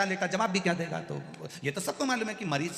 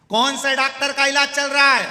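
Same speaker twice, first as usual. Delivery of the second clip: high, loud question with a long fall; shouting; 10 semitones higher, 10 dB louder than usual. Retold or imitated. Deaf grandfather yells at the patient to ask about his doctor. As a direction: yell it across the bed.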